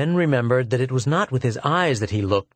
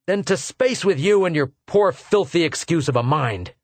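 loud, higher, fierce snap back; fierce retort; 5 semitones higher, 3 dB louder than usual. Acted. Arviden lashing out at the bigoted lieutenant, fierce. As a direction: hurl it at him fiercely.

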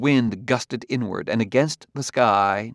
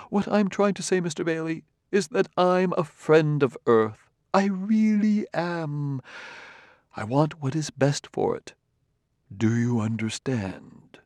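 softly running, ingratiating; 7 semitones higher than usual. A narrator's reading. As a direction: press your friendship gently and smoothly. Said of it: "the softly running sentences of the other".

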